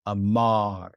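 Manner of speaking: "amar" said slowly and clearly, stress on the final "mar"; Hebrew word amar. Shown as a demonstration. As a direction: say "amar" as a careful teacher's model. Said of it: "let me say the word for you again".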